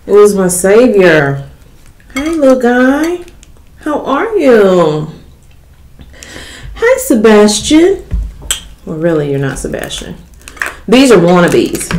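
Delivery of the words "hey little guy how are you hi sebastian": high, slow cooing at a tiny pet; playful baby talk to a seafood claw. Pretend. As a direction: coo at the crab like a pet.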